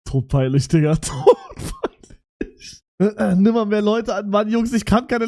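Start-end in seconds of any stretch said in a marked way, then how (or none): 1.0-1.9 embarrassed squeal. Real, not acted.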